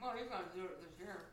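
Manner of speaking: talking through a mouthful; words round a bite of food; mouth full. Real, not acted. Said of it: "Talking with my mouth full".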